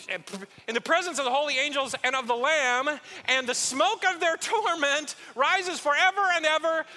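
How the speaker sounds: hammed-up warning shouted over the back fence; dramatic doom reading. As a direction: pour it on, grim and heavy. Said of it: "a little gravel in the voice".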